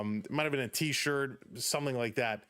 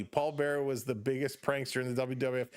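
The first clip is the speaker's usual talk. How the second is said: ordinary delivery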